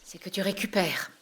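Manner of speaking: soft, low, half-whispered; hushed. Acted. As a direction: speak softly to a recovering patient. Hushed talk at sickbed. (doucement, à voix basse)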